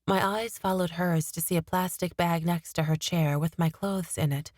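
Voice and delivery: plain reading voice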